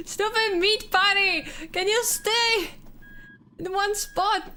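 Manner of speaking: high laughing scold; laughing insult. Real, not acted.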